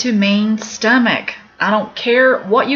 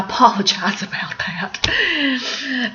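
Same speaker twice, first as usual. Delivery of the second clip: whispered apology; 4 semitones higher, slower than usual. Real, not acted. Apologizing for her accent.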